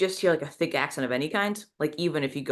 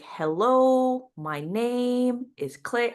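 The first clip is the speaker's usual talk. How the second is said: drawn-out, higher-pitched imitation of over-careful talk; speaking really slowly; 8 semitones higher, much slower than usual. Half sister meeting Nikki, assuming he wouldn't understand, speaking really slowly. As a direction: stretch each word out high for someone who might not understand. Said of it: "speaking really slowly".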